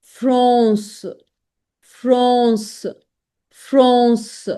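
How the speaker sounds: nasal French vowel in "France", like frons; French pronunciation demo. Shown as a demonstration. Teacher models CE ending, drawn-out French frons. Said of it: "it gives a sound of S".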